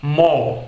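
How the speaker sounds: "mo" with no R at the end, just M and O; British no R. Shown as a demonstration. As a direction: drop the R in "mo", like a cow's moo. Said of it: "Mais uma vez". ("mo" sem R, britânico)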